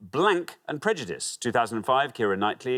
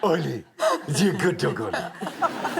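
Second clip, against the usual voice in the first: higher, playful pet-talk with laughter; laughing good-dog voice; 5 semitones higher than usual. Pretend. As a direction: praise a puppy through giggles. Talks to the puppy, laughing.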